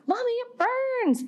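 put-on very high wailing little-boy voice; imitating crying toddler. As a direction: wail it like a hurting three-year-old. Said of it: "he starts screaming bloody murder".

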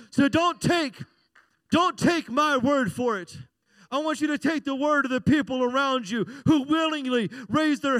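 loud, rising preacher calling on the church; full-force preaching. Acted.

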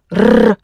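"R" as a trilled Russian letter sound; long rotating trill on "R"; rolled R. Shown as a demonstration. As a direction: roll the "R" on the tongue. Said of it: "the rotating R".

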